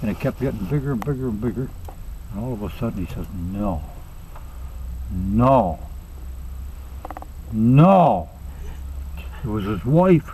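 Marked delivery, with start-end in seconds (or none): 3.3-8.3 dawning dread. Acted. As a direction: build from stunned murmur to firm refusal. Man spots his wife approaching heaven.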